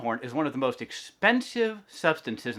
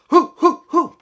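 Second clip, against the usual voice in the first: very high, very loud hoots; hooting rhino call; 16 dB louder, slower than usual. Acted. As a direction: hoot like an excited baby rhino. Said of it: "She made funny sounds".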